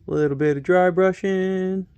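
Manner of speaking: a light hint of a TV painting instructor; playfully channel a gentle painting host; lightly done TV painter calm; Bob Ross voice. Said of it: "Am I becoming a miniature painted Bob Ross?".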